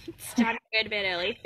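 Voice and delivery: laughing through a friend's reminder. Real, not acted. Quoting her safe people's bedtime nagging, laughing.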